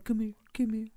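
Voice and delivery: a touch of a slurred lazy come here; mocking sleazy boyfriend. Mocking the boyfriend calling from bed, lightly slurred. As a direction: mimic him lounging in bed. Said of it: "motherfucker's in the bed talking about".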